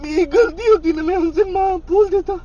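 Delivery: choking up while confessing; tearful. Real, not acted. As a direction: voice cracking, pleading through tears. Crying to friend on call.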